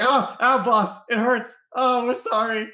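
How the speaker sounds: high, pained whine to the boss; whimpering thug. Acted. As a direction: yelp like a hurt lackey. Beaten thug whining to boss.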